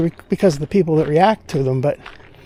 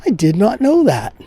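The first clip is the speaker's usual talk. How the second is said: lightly mock-formal catchphrase; Johnny Carson catchphrase; 4 semitones higher than usual. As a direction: say it like a late-night host's bit. Catchphrase said with odd emphasis.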